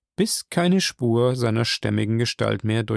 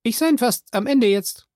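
Jupiter's voice; 10 semitones higher than usual. Acted. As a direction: pitch him well up and call out. Jupiter calls down.

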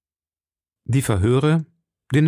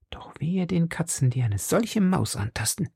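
softer whispered threat; menacing whisper; 7 semitones higher, 5 dB quieter than usual. A narrator's reading. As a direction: whisper the threat with grim relish.

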